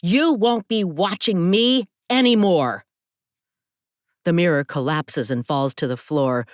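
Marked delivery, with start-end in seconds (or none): 0.0-2.9 high, fed-up outburst; yelling. A narrator's reading. Telling off the watchers behind the smashed mirror, yelling. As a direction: yell it higher, building in volume. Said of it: "I yell".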